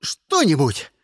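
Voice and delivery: high-pitched hysterical threat; yelling. A narrator's reading. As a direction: yell frantically, demanding release. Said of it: "заорал Кристиан". (истерично орёт высоким голосом)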